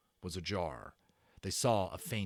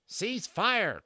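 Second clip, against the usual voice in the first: high, loud call; cried out; 11 semitones higher, 5 dB louder than usual. A narrator's reading. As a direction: call the tiny men to stop. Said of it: "cried Patrick".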